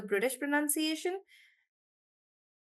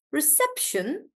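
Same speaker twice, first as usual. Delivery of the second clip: reception with SEP lifted high; stress on SEP; 4 semitones higher, slower than usual. Shown as a demonstration. Teacher repeating reception, sep stressed. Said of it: "stress is on sep".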